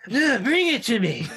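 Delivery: put-on drunk demand. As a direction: bark the order like a drunk.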